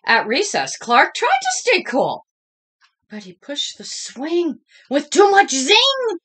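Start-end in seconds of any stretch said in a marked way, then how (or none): none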